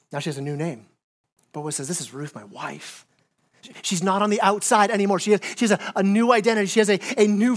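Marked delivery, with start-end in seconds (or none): 0.1-3.1 tender hush. Real, not acted. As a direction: hush the room for the reveal. New name reveal.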